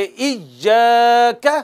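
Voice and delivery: इय्याका with the ा held one alif; long alif. Shown as a demonstration. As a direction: stretch इय्याका. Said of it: "एक अलिफ के बराबर हम पढ़ेंगे". (इय्याका, एक अलिफ खींचकर)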